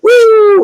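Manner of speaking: imitated gushing whoosh; loud high whoop. Storyteller showing pus spurting from an arm, high whoop. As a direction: shoot out a high, loud "woo".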